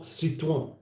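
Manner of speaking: Citroen with a French ending, "seet-wan"; French accent. Shown as a demonstration. Host shows how not to say it, French accent. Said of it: "Unless you're doing a French accent".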